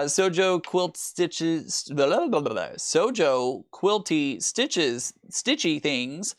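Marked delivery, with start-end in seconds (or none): 0.0-1.8 slow. Real, not acted. Reading a tricky name slowly. 2.8-6.3 slow deliberate tongue twister; slow, careful tongue twister. Real, not acted. Stumbling over the quilt brand name, slow careful reading. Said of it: "Say that name five times fast".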